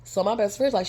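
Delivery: ordinary delivery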